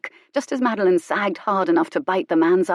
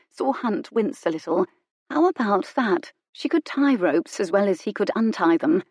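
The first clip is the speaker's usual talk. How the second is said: ordinary delivery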